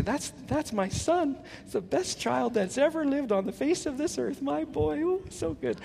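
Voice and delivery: high, soft gushing mom voice, slowed down; mock doting mother praising her son. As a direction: coo over him like a proud mom who sees no fault. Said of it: "the mom will say".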